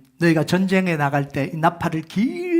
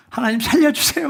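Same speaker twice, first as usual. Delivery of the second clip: high, louder mock cry for help; panicked plea; 10 semitones higher, 6 dB louder than usual. Boy running past the shrine at night, panicked plea. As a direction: plead like a scared child running. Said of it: "막 그 길을 벗어나요".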